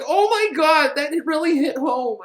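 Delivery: high mock anguished cry. Inmate hurt by news of divorce, high mock anguish. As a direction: wail it like a hammed-up broken man. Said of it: "He's like".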